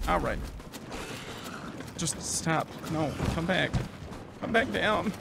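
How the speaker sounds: muttered frustration. Real, not acted. Muttering while chasing monster.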